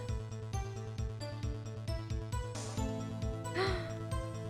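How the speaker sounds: sharp gasp. Real, not acted. Gasp at new raid.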